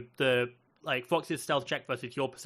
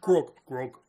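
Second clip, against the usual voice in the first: Grog; 6 dB louder, slower than usual. Shown as a demonstration. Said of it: "just gotta channel Grog".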